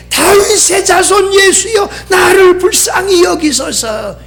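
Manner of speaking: high, wailing retold plea; high-pitched cry for mercy; crying out harder. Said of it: "더 크게".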